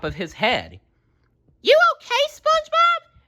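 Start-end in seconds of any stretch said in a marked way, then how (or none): 1.6-3.2 very high, loud worried call; checking on a hurt friend; Sandy voice. A narrator's reading. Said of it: "asked Sandy".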